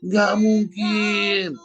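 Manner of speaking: high, very drawn-out mock denial; mock disbelief. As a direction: stretch it out high in teasing disbelief. Mimics mama's naive disbelief. (tak percaya dibuat-buat, tinggi dan panjang)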